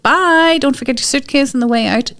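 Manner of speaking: high, gleeful send-off; cheery goodbye. Retold or imitated. The child gleefully seeing her dad leave, high and bright. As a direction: wave the dad out happily. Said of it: "I'm like".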